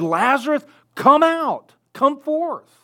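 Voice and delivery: high ringing call; Jesus calling Lazarus. Acted. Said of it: "he said".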